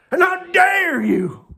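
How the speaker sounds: high, drawn-out outraged cry; how dare you, high and indignant; outraged shout. Real, not acted. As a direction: hurl it at them in disbelief. Ranting at covid messaging.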